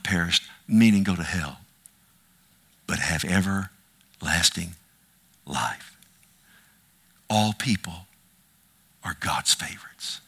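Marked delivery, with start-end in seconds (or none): 2.9-10.2 low, softened, weighty phrases with pauses; solemn hush. Real, not acted. Preacher promising everlasting life to all people, solemn and hushed. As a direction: let it sink low, almost to a whisper.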